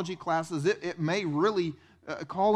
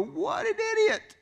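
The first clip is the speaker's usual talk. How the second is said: slow, very high mocking cry; scornful exclamation; slower than usual. Churchgoer scoffing at the servant in the story. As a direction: drag it out in disbelief. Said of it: "we look at this story and go".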